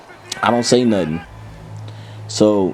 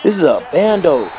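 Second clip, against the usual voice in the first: friend explaining; 6 semitones higher than usual. Retold or imitated. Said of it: "He was like".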